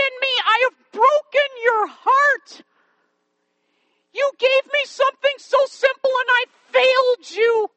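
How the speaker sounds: put-on high, loud anguished plea of a child; bitter crying, acted out. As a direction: wail it out as the heartbroken girl, begging forgiveness. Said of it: "she cried bitterly".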